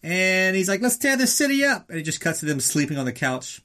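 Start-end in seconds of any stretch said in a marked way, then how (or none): 0.8-1.9 very high, excited cry; rally your buddy for a wild night; hyped-up high-pitched exclamation; fired up. Acted. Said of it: "he's all fired up".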